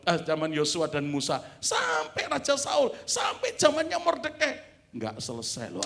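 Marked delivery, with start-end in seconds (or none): 1.6-4.5 shouted, higher and louder, dragging Saul out slowly; preaching shout. Real, not acted. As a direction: build to a full preacher's cry. Preaching climax, shouted list.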